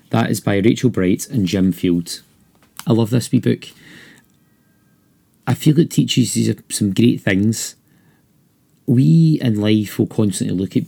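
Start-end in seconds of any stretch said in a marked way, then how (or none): none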